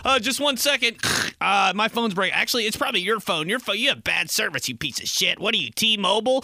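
high, fussy phone voice; irritated caller. Acted. Caller on a bad connection blaming the listener, irritated. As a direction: blame the other person's phone, pestered.

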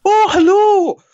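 hammed-up high falsetto lady voice, laughing; Mrs Doubtfire lady. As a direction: play the wife in a silly falsetto. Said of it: "female accent I can do is".

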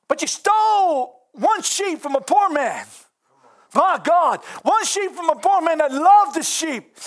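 fiery preacher's shout of rebuke; preaching at full force. Acted. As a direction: thunder the accusation like the prophet. Nathan rebukes David, preacher erupts.

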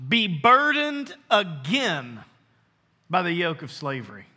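slow, sinking verse reading; read nice and slow. Shown as a demonstration. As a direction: stretch it out and let it settle. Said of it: "I'm gonna read it nice and slow".

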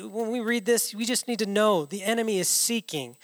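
ordinary talk

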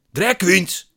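high, loud exasperated shout. A narrator's reading. Man repeats it to puzzled narrator, shouting. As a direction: yell the correction out. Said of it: "schreeuwt hij".

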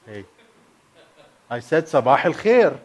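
normal talking voice

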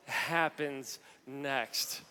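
drawn-out, hushed question; very slow, hushed suspense. Acted. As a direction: let each word hang in suspense. After the courtroom scene.

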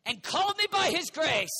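preaching. Shown as a demonstration. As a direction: proclaim it out over the crowd. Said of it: "ooh, his loving kindness".

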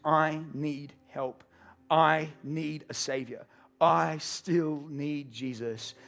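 each phrase said very slowly; very slow, earnest confession; slow heartfelt plea. Acted. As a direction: let each need land, slow and earnest.

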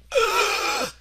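sharp startled high gasp; high frightened gasp. Retold or imitated. Child's fright reaction acted.

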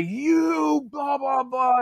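high-pitched sergeant tirade; mock angry ranting. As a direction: nonsense name-calling in a high mock yell. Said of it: "starts calling me names".

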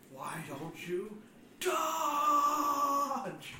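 playful yell, rising hard on dodge; mock shout. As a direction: bellow it like a frustrated coach. Mock yelling at a dodging enemy.

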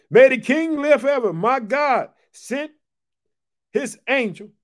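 loud pulpit scripture reading. Acted. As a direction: declare Daniel's answer to the king. Preacher reads scripture aloud.

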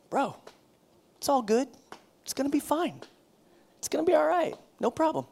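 high, airy brush-off; breezy dismissive. Shown as a demonstration. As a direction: toss it off like it's nothing. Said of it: "That seems a little weird".

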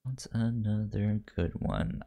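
ordinary delivery

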